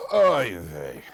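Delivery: loud, low, drawn-out groan; hammed-up oy vey; krechtsing for show. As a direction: heave a big groan for an audience. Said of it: "when other people are going".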